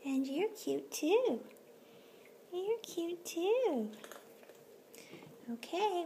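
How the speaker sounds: very high squeaky pet voice. Real, not acted. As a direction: coo compliments at the kittens. High baby talk to kitten.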